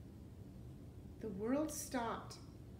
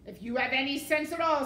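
loud, raised villain warning; scolding warning; 5 semitones higher, 11 dB louder, faster than usual. A narrator's reading. Rat warning the armed serving girl, loud scorn. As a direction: warn her loudly and scornfully.

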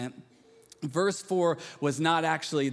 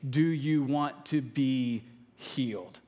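tender searching question; 3 semitones lower, slower than usual. Real, not acted. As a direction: lean in and ask it gently. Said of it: "preacher's key question".

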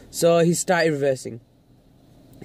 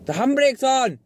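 higher, insistent exclamation; exasperated call; 7 semitones higher than usual. Real, not acted. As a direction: call out in exasperation at a clueless driver. Exasperated repeat at oblivious driver.

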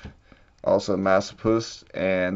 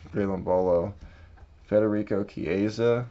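ordinary talk

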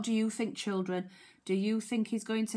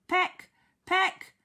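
each "peck" high, louder and separate; pecking words; 9 semitones higher, 5 dB louder than usual. Acted. Teacher acting Duck pecking the rod, jabbed words. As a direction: jab out "peck" high, like a beak striking.

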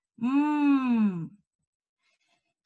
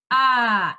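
"A" called out loud and open; loud vowel demo; 7 dB louder than usual. Shown as a demonstration. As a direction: model the A loudly for the class. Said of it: "esta es la vocal".

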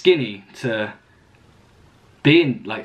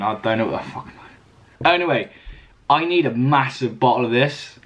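normal talking voice

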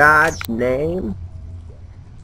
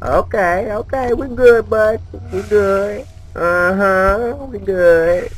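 mock soothing; 8 semitones higher, slower than usual. Soothing the monster in falsetto.

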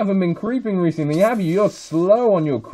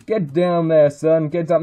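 usual speaking voice

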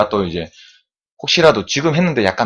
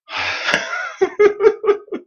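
a high giggle at himself; high-pitched laugh. Real, not acted. Laughing at his own sweat, high-pitched laugh. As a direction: burst out in a high squealing laugh.

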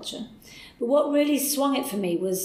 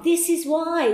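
higher, louder proud declaration; emphatic repeat; 8 semitones higher, 5 dB louder, slower than usual. Retold or imitated. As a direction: hammer it home the second time. Repeated declaration while writing.

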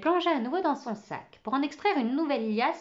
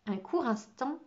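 plain reading voice

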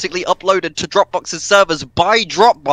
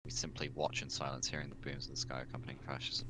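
hushed narration, fast whisper; 25 dB quieter, faster than usual. Acted. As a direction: whisper it like a secret. Dramatic reading, whisper.

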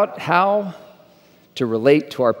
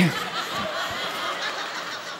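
breathy laugh; 11 dB quieter than usual. Real, not acted. Laughs at own joke.

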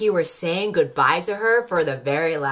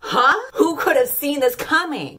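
higher, louder mock shock at the obvious; sarcastic fake surprise; 10 semitones higher, 4 dB louder than usual. Vlogger mocking the obvious twist, fake astonishment. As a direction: gasp like it's a real twist. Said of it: "sarcastic fake surprise".

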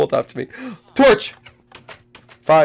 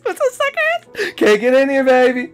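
taunting; 6 semitones higher than usual. Real, not acted. Taunting seekers locked out.

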